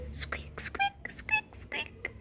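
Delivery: tiny high unvoiced mouse squeak; squeaky mouse. Shown as a demonstration. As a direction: squeak like a little mouse for the child. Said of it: "What sound does a mouse make?".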